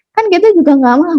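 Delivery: ordinary talk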